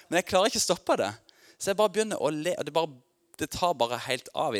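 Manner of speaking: mye høyere og kraftigere i gjenopplevd glede; begeistret. Real, not acted. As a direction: bryter løs i fryd mens han forteller. Forteller om latteranfall i ånden. (høyt og høyere, full av glede)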